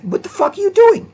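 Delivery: very high, loud outraged yell; hammed-up outrage; mock incredulous shout. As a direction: shriek it in disbelief. Incredulous reaction quoted.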